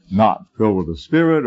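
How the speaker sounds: normal talking voice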